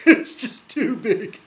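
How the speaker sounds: can't hold back a laugh at the fez; laughing while talking. Real, not acted. Mocking friend's oversized fez.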